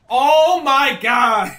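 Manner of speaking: loud, drawn-out shocked cry; shocked shout. Real, not acted. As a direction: yell it in sudden disbelief. Sudden in-game shock.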